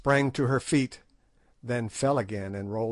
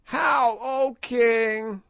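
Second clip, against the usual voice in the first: high, louder, sneering crone; a hag taunting a king to his face; scornful witch daring the king; mocking old witch; 12 semitones higher, 4 dB louder, much slower than usual. A narrator's reading. Gagool mocks again.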